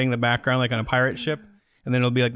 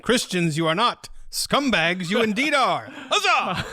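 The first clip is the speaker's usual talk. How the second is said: higher, hammed-up old-timey proclamation; Renaissance fair crier; 8 semitones higher, slower than usual. Reading hate mail as a Renaissance fair herald, hammed-up and high. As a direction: declaim it like a town crier at a fair. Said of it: "It's very Renaissance".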